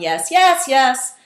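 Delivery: "yes, yes, yes" high and loud, stepping in pitch; changing intonation. Shown as a demonstration. Students answering "yes, yes, yes" in the call's high, loud tune. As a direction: echo three times, "yes, yes, yes" up then down. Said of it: "change the intonation of your voice".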